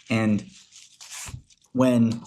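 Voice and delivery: ordinary talk, nothing marked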